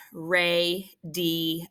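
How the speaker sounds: "radio" slowly in three parts, ray-dee-oh; sounded out. Shown as a demonstration. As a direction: say "radio" syllable by syllable with an ee in the middle. Word sounded out by syllables.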